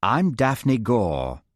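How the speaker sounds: slow, languid drawl; bored drawl. Acted. Tall blonde introducing herself to the group, slow bored drawl. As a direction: stretch the name out, utterly bored. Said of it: "drawled a tall blonde".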